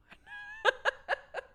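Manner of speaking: high laughing whisper of confusion; whispered mock panic. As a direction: act lost, half laughing, half crying, whispered. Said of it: "I'd be like".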